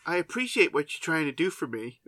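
a hint of prim sincerity; imitated film heroine. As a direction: lightly mimic an earnest girl. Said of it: "she says to him".

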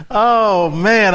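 usual speaking voice

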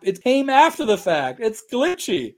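high, loud mock protest; mock indignation. Mock protest about his glitchy accent.

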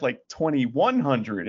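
usual speaking voice

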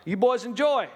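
high, loud, showy offer; sleazy dad. Retold or imitated. Dad offering magazines to his boys, high and loud. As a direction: hand it over like a party host.

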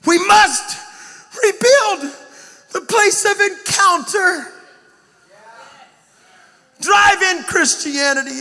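loud, high, sung-out preaching; preaching at full force. Real, not acted. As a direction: preach it out to the crowd, half singing.

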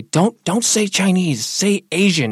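imitating a wife, slow, loud and high; urgent correction. Wife correcting her husband's wording, emphatically.

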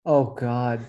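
usual speaking voice

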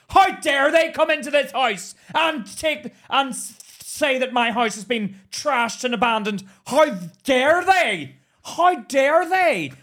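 loud, high, indignant shriek; imitating outraged mum. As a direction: go ballistic as the mum, dragging out the last cries. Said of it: "Mum went fucking apeshit".

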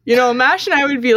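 ordinary delivery

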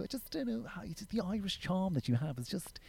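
high, soft, put-on English voice; English coworker. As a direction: mimic the lovelorn English colleague, high and hushed. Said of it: "this guy that I worked with who was English".

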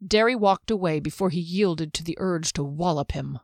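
ordinary delivery, nothing marked

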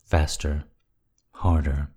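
usual speaking voice

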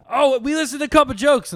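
high bright customer voice; high chirpy customer voice. Pretend. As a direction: chirp it like an eager shopper. Acting out store customer.

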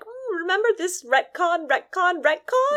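high sing-song nagging voice, rising to a squeak; mocking game voice. Mocking the game's nagging reminders in a high sing-song. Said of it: "they were just like".

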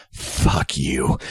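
low, flat insult; blunt director. Retold or imitated. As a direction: deliver it dead low and cold. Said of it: "And he just goes".

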